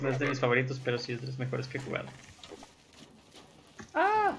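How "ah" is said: sharp high scream of fright; startled scream. Real, not acted. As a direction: shriek as something jumps out. Startled by the game. (grito de susto agudo)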